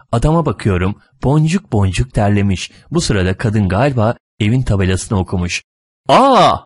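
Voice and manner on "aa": short, high, loud gasp-shriek; shocked shriek. A narrator's reading. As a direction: cry out "Aa!" in shock. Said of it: "diye bir çığlık atıyor".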